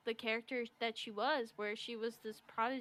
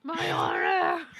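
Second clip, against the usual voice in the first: loud, drawn-out, higher melodramatic bellow; mock-dramatic shout; 5 semitones higher, 12 dB louder, much slower than usual. Co-host echoes the play's Zuko line, mock-dramatic. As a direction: bellow it slowly like a ham actor.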